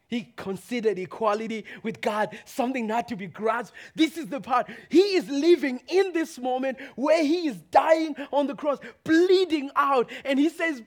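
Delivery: loud, high, fervent preaching; shouted preaching. Acted. Preacher crying out the psalm to the church. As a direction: bring the house down with conviction.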